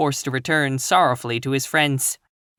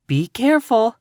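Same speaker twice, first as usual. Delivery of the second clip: high, louder quick warning; cried warning; 10 semitones higher, 5 dB louder than usual. A narrator's reading. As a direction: call out to stop her. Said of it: "cried the green girl".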